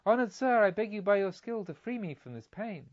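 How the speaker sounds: pleading woman. A narrator's reading.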